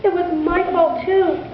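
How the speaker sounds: put-on high, earnest plea of a young boy; lightly voiced boy pleading with mother; pleading child. A narrator's reading. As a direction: beg your mother to let him in.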